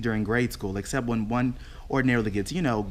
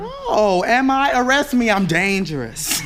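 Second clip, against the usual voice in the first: mock indignant; 11 semitones higher, 5 dB louder, slower than usual. Mock outrage on phone.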